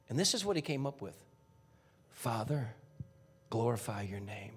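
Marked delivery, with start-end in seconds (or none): 2.1-4.5 low, soft prayer to the Father; hushed prayer. Retold or imitated. Jesus in distress praying softly to his Father. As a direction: pray it under your breath.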